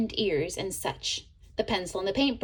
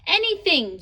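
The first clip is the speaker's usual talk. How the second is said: high, loud excited yell; an eager paintbrush yelling; 8 semitones higher, 5 dB louder than usual. A narrator's reading. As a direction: shout it out with glee. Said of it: "yelled Kitty".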